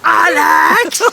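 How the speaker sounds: high, loud, desperate scream of a name; imitated screaming kid. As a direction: scream the player's name like a frantic young fan. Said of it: "he was going".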